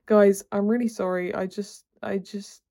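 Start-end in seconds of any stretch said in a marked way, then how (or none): none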